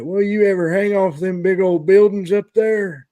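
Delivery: put-on high, loud old-timer holler; hammed-up old man voice, pitched high; old guy. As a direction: imitate a nosy old guy calling up. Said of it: "there's always some old guy".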